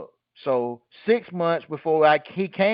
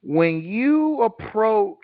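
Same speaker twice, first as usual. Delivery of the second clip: said slowly; 6 semitones higher, much slower than usual. Shown as a demonstration. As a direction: stretch every word out on purpose. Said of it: "I'm gonna say it slowly".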